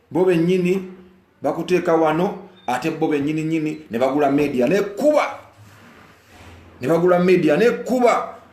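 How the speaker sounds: loud, high indignant rant; angry rant. Real, not acted. Singer ranting about bought media, loud and angry. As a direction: rail against those who betrayed him.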